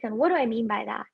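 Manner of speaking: ordinary delivery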